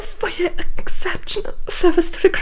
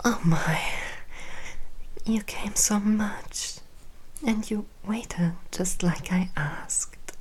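hushed, tender afterglow whispers; soft whisper; 7 dB quieter than usual. Acted. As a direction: whisper softly after climax.

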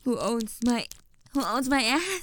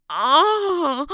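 loud cry of pain; 5 semitones higher, 10 dB louder than usual. Acted. Sudden pain.

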